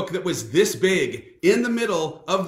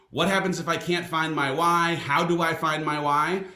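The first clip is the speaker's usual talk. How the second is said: normal talking voice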